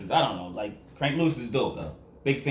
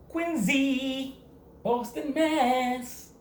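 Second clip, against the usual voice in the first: hometown shout-out; 13 semitones higher, much slower than usual. Acted.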